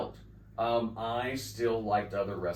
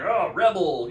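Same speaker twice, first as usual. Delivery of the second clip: mocking taunt; 7 semitones higher, 8 dB louder than usual.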